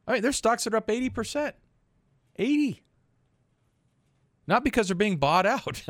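high, fast, amazed; rising excitement, up to a high "Eighty"; excited. Real, not acted. Amazed at stock gains.

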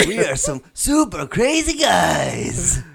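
high, slow, loud spooky ghost. Pretend. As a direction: croon it like a cartoon ghost. Continues the ghost voice bit.

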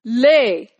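loud barked command; giant shouting. Acted. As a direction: bark the order loudly. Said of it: "He shouted".

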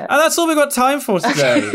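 high-pitched pretend presenter wrap-up; mock host sign-off. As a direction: cut the guest off with a hammed-up sign-off. Mock cutting off the guest's question.

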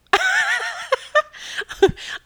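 real high, delighted laughter; high-pitched laugh. Real, not acted. Storyteller amused by the eagle flying off, laughing high.

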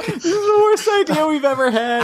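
high, laughing despair. Real, not acted. Host gives up on the impression, high laughing despair. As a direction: laugh it out, giving up.

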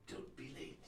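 hushed menace; whispered threat. Acted. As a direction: breathe the warning. Villain's parting warning.

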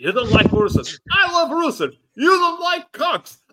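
high, climbing mock argument; rising mock wrestler voice. Acting out Vince McMahon bit.

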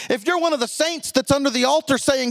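usual speaking voice

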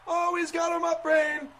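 high, loud excited commentator. Acted. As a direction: shout the play-by-play like a wrestling announcer. Commentator calls the suplex.

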